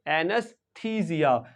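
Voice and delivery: "एनेस्थीसिया" slow, long "थी", Z for S; pronunciation demo. Shown as a demonstration. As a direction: teach "एनेस्थीसिया" slowly as a-nus-THEE-zia. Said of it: "क्या pronounce करेंगे?".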